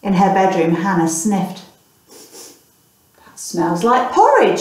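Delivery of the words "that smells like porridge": very slow sniffing musing; thinking aloud. Acted. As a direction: draw it out while sniffing. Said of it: "she thought".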